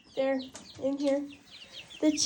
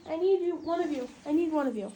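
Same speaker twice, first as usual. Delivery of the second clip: ordinary talk, nothing marked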